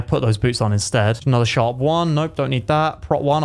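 ordinary delivery

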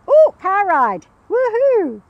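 high, loud whoop as a thrilled dog; loud, high-pitched pretend glee; excited dog. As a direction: squeal it like a dog promised an outing. Voices dog thrilled at car ride.

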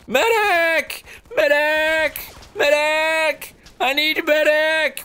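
high, far-carrying call for medic; man down shout. Acted. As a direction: holler across the battlefield. Calling for medic on field.

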